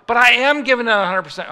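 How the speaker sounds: high, loud insistent objection; indignant protest. Acted. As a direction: plead your case loudly, exasperated. Acted protest at stepped-up demand.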